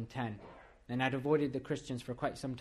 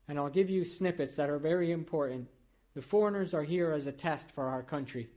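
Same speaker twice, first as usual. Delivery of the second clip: louder, higher preacher's build; fervent preaching; 4 semitones higher, 4 dB louder than usual. Acted. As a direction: warn the church with rising force. Preaching to congregation.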